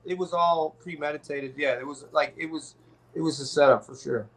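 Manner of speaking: ordinary talk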